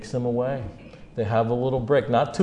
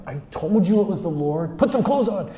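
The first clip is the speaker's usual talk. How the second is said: high-pitched, excited chiding; urgent exclamation; 8 semitones higher than usual. Acted. John to Peter on seeing the Lord, excited urgent chiding. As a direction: press it on him urgently, voice climbing.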